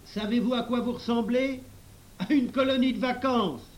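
high, loud teasing call; shouting. A narrator's reading. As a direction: yell it out to the whole crowd. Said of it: "Pony Bibi cria encore".

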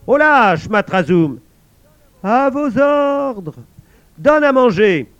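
high loud shout; guard shouting. Acted. Guard copying the old men, shouted orders. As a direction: call out into the dark cave. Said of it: "Il quitta l'armoire et cria".

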